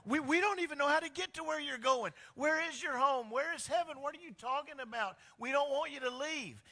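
high, loud, anxious disciple pleading; a frightened follower begging him not to go; Thomas. Retold or imitated. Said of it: "And Thomas is like".

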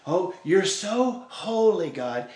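sarcastic. Acted. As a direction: lay the sarcasm on slowly, as if praising God. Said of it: "you're gonna hear some sarcasm in their voice".

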